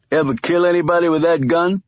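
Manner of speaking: a hint of a teasing kid; cocky young man. Young man needling Stone about his gun, lightly voiced.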